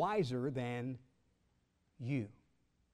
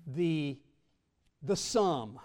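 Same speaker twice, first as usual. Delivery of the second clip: ordinary talk, nothing marked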